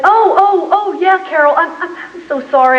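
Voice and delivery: high, flustered oh-ohs, retold; embarrassed apology. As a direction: stammer an apology to the neighbour, starting high. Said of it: "So embarrassed".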